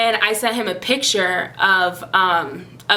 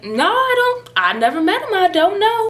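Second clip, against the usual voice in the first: tag puzzled denial; 11 semitones higher than usual. Retold or imitated. Acting out their puzzled denial.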